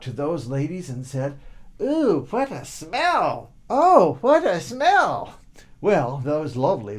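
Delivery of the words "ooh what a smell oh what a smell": higher, louder, slow disgusted cry. Acted. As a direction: wrinkle your nose loud and slow. Boy to perfumed ladies.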